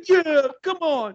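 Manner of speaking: high, drawn-out mock nu metal frontman. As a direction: imitate a nu metal singer, laughing. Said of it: "He's got, like, this new metal voice".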